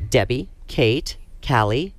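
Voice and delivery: usual speaking voice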